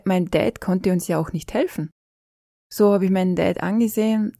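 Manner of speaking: ordinary talk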